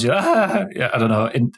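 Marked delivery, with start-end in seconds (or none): 0.2-0.7 sudden high yelp of surprise; sharp high gasp of surprise; startled yelp. Shown as a demonstration. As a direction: yelp like a reflex jolt. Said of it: "spasmodic response".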